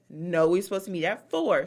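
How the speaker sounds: firm correction. Retold or imitated. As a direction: correct them firmly in your own voice. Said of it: "She said".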